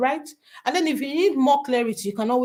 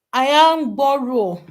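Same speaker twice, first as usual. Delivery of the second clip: name read out in a Yoruba accent; 3 semitones higher, 5 dB louder, slower than usual. Real, not acted. Host greeting a viewer by name, Yoruba accent. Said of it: "called that with a Yoruba accent".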